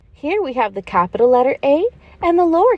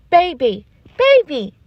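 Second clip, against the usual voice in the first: put-on kids repeating; 3 semitones higher than usual. Pretend pupils repeat the word.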